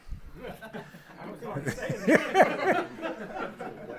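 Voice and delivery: breathy high laugh at himself; real laugh. Real, not acted. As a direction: laugh at the crowd's reaction. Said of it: "You can see I".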